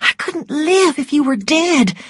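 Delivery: high, frantic inner cry; wild thought. A narrator's reading. Scarlett silently panicking over Ashley, high and frantic. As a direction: panic rising inside her. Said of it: "she thought wildly".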